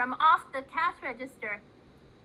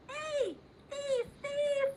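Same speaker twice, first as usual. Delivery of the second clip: put-on high, soft childlike cry of thief; little boy crying thief; 12 semitones higher, 7 dB quieter, much slower than usual. Zeke calls out at the thief, high and small. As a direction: squeak it out like an upset little kid. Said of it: "said Zeke".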